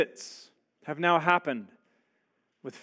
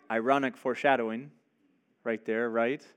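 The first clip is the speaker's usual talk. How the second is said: normal talking voice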